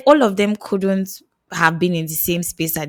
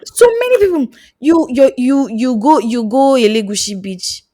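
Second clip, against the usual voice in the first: excited; 5 semitones higher, 6 dB louder than usual. Real, not acted. Excited loud ranting.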